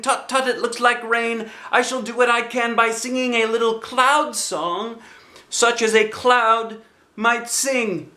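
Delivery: high, bright bear voice calling out; Pooh's cheerful cry. Acted. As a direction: call like a happy little bear. Pooh voiced.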